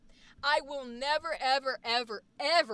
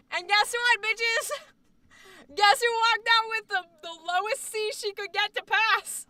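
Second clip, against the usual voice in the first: high, loud gloating crow; triumphant boast; 7 semitones higher, 5 dB louder than usual. Real, not acted. Student bragging about getting the passing grade, triumphant boast. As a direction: crow about your win.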